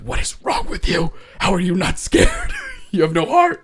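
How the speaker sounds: high, strained mock whisper-shout. Terrified husband to his stone-faced wife at the movie, mock whisper-shouting. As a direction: imitate a scared husband hissing in panic. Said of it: "whisper shouting at her".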